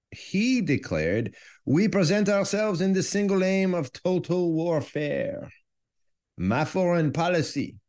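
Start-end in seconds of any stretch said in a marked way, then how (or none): 1.6-7.8 put-on quoting Clemenceau in a higher French accent. Podcaster quoting the French prime minister, in a French accent. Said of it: "That's why I have this cool accent".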